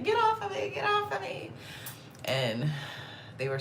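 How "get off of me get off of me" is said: high frantic plea; high but quiet mock struggle; mock panicked plea. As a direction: squeal it while being grabbed. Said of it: "I'm like".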